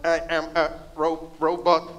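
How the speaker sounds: stilted mechanical robot voice; slow, halting robot speech; toy robot voice. Acted. Staged robot skit on stage.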